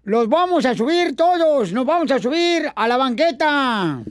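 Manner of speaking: lightly pompous campaign orator; mock politician rallying the crowd; booming balcony speech. Mock candidate speech, Poncho Corra. (discurso de candidato pomposo)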